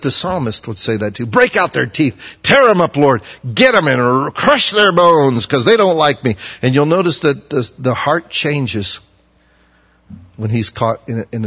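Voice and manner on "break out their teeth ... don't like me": loud, higher, raging prayer for revenge; vengeful plea. Acted. Psalmist crying out to God for revenge, loud and raging. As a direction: rail at God to crush the enemies.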